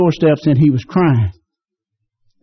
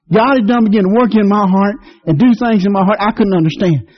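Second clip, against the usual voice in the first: ordinary delivery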